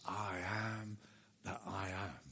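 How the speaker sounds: low, soft and weighty; God naming himself, hushed and low; solemn hushed declaration. Acted. God's answer to Moses.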